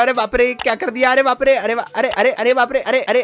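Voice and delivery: high-pitched, stammered alarm; panicked cries. Pretend. Passenger panicking at the rider's stunt, high-pitched. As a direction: keep yelping in shock. Rider does something crazy.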